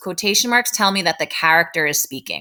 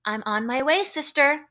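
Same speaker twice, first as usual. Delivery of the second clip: high, light call back, softer than a shout; 6 semitones higher, 5 dB quieter than usual. Acted. Teacher reads Baby answering her sister, calling back lightly. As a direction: answer in a raised pitch, rising on the last word. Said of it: "shouted Baby".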